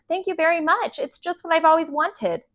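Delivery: high, soft and dutifully polite; forced politeness. A narrator's reading. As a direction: say it sweetly, just to be polite. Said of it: "had to be polite".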